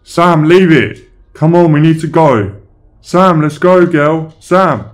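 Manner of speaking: loud, high-pitched urgent yell; panicked shouting. Acted. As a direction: shout at the dog to leave it and come away. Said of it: "I was paranoid and started shouting".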